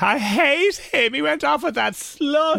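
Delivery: high, slow, whiny sobbing imitation; mock heartbroken teen. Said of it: "when you'd be crying 'cause the boy didn't like it".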